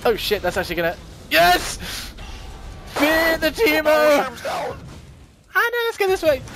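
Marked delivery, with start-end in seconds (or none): 1.3-4.4 high, loud victory crow; triumphant shout. Real, not acted. Player boasting to enemies after a kill, triumphant shout. Player crowing over a game win. 5.5-6.4 high, urgent yelp; panicked exclamation. Real, not acted. Player fleeing enemies with teammates, panicked exclamation.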